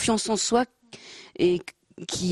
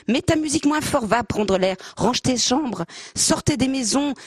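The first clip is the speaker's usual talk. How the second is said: mild mock shout; 6 semitones higher than usual. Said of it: "Mère crie".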